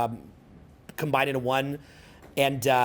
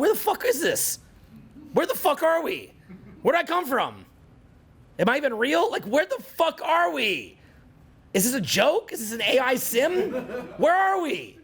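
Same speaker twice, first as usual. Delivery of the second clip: much higher, incredulous questioning; bewildered panic; 9 semitones higher than usual. Retold or imitated. Alone in nature at forty: much higher, incredulous questions. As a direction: spiral into disbelief at it all. Imagined existential pause, audience.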